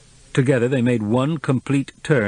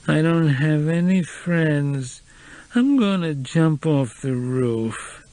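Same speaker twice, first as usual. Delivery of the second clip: high slow slurred whine; drunk whining; 6 semitones higher, much slower than usual. A narrator's reading. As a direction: slur it, self-pitying and drunk. Drunk woman self-pitying.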